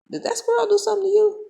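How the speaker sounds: very high, quick put-on voice; mock concerned assistant. Assistant asks the boy about the squirrel, high mock voice. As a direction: pipe the question up high and fast.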